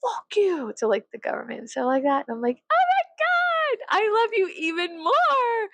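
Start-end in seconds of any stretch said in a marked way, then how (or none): 0.0-0.7 high-pitched, feisty mock defiance; mock angry old lady. Old ladies cursing the government, mock angry. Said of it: "old ladies get mad and taking a stance". 2.7-5.7 very high, drawn-out fangirl squeal; mock gushing. Fan adoring defiant old ladies, gushing squeal.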